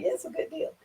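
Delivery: breathy whisper, high and very soft; whispered aside. Retold or imitated. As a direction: whisper it to yourself, pleased. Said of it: "I was like".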